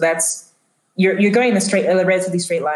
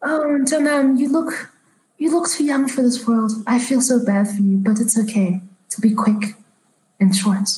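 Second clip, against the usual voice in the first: put-on pitying old lady, high at first; elderly woman; 4 semitones higher than usual. Elderly woman pitying a young stranger, in character. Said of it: "she looks at you, Tessa, and she says".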